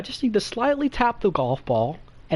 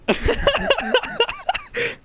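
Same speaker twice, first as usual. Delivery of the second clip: high-pitched laughing fit. Real, not acted. Storyteller cracking up mid-story, high giggling fit. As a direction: crack up in high, rapid bursts.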